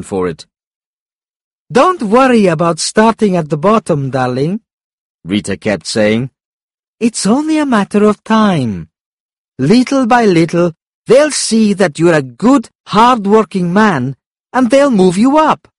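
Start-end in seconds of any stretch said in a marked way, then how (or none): 1.7-4.6 higher-pitched encouraging partner's voice; lifted, supportive character voice; reassuring Rita. A narrator's reading. Rita reassures Joe in story. 7.0-15.7 high-pitched reassuring partner; raised, supportive character voice; encouraging Rita. A narrator's reading. Rita keeps encouraging Joe.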